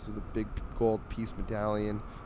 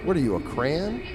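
higher, rising mock-puzzled question; incredulous cat; 7 semitones higher, 4 dB louder than usual. Cat asks the oddly dressed dog, incredulous. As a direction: quiz them as a baffled cartoon cat.

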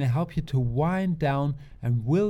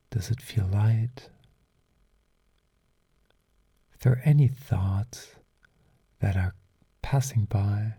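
guided sleep meditation; 4 semitones lower, 6 dB louder than usual. Acted. Host guides listeners into a calm and relaxed state, guided meditation. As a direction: ease the listener toward sleep, unhurried and lower-pitched.

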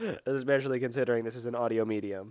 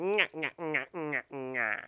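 sing-song taunting; slower than usual. Acted. Adam Sandler taunting in football.

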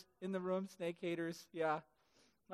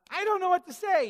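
higher, louder Moses, helpless excuse; whiny protest; 9 semitones higher, 8 dB louder than usual. Acted. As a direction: plead you have no words.

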